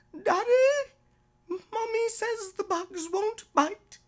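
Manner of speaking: put-on high, loud child's voice, upset; frightened little girl. Daughter upset in bed calling to daddy, high and loud. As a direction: cry out to daddy like a scared small girl.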